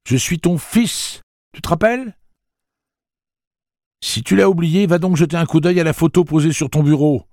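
high, loud sarcastic jab; high mocking son, stress on ton; mocking taunt. Jake taunts his father.

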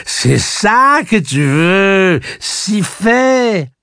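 high, loud, drawn-out taunting shouts; enraged, stretched-out jeering yell; furious, with angry smile. A narrator's reading. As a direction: hurl each phrase at her in rage. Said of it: "avec un sourire rageur".

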